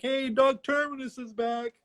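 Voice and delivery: high excited lookout voice; mock lookout call. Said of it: "he's like".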